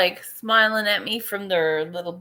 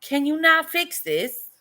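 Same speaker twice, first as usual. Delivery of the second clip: pleading whine; 7 semitones higher than usual. Retold or imitated. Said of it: "And I'm like".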